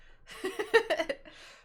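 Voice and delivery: high burst of laughter; helpless high laugh at yourself. Real, not acted. Laughing at their own new way of saying accent, high laugh.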